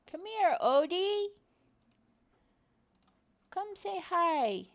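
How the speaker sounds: high, coaxing pet voice; calling the cat. Real, not acted. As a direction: coax the cat to come over.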